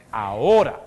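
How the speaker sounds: AHORA hit loud and sharp; urgent stress. Real, not acted. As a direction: punch the word Ahora as a command. Llamado urgente. (Ahora, dicho fuerte y urgente)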